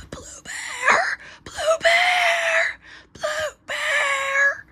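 high, strained, voiceless scream; lost-voice yelling. Acted. Calling Blue Bear for help, lost-voice yelling. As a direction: try to shout with your voice gone. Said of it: "I don't have a voice".

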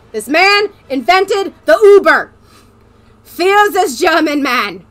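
put-on loud, slow German-accented proclamation; booming stage-German announcement. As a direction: declaim it grandly in a German accent. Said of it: "I'm gonna be doing a German accent".

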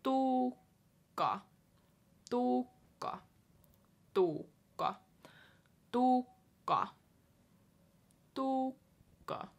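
Tukka with a crisp double k; Tukka, clear Finnish k held; Finnish name said slowly. Shown as a demonstration. Teacher modelling Finnish name for learners, careful. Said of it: "take your time with it".

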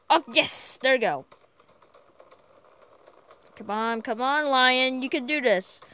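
usual speaking voice

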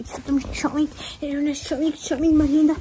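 loud, high, gushing praise for the dog; doting dog talk. Real, not acted. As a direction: gush over the dog like a proud owner. Cooing praise to her dog Chomik. (hablándole a la perra con cariño exagerado)

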